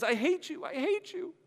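high tearful Smeagol. Acted. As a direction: wail it at him, childlike. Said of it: "Smeagol says".

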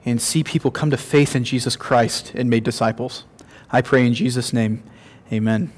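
soft pastor's prayer. Acted. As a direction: pray quietly and reverently. Leads closing prayer.

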